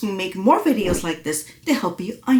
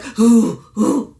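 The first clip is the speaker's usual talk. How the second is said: pushed from the throat, louder and tight; strained throaty hoo; 5 dB louder than usual. Shown as a demonstration. Coach demonstrates the wrong straining hoo. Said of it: "You see that straining?".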